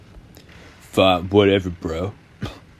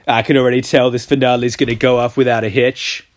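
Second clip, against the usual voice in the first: usual speaking voice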